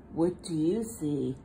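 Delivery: plain reading voice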